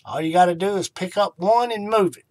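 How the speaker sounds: ordinary talk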